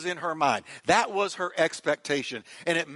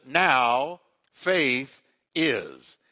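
very slow, every word weighed, lower; drawn-out scripture quote; 4 semitones lower, much slower than usual. Acted. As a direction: stretch "Now faith is." out word by word, landing hard on "Now". Preacher quotes the Bible emphatically.

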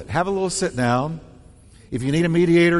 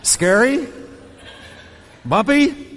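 higher, jolted like a jet ski; jolting ride; 7 semitones higher, slower than usual. Acted. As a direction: bounce it out.

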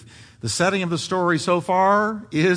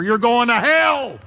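higher, slow thunderous cry; thundering warning; 7 semitones higher, 5 dB louder, slower than usual. Acted. Voice of the Lord warning the stubborn sinner, thundering. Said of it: "a voice of warning like thunder".

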